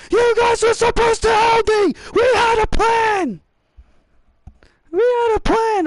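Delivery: high, loud furious complaint; angry wrestler voice. Acted. Chad yelling at his team, mock angry. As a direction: shout it as the betrayed coach.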